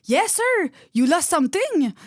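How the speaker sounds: perky retold call to a customer, pitched high; high, singled-out customer-service call; high-pitched greeting. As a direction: imitate yourself chirping at a returning customer. Greeting customer who returns, acted.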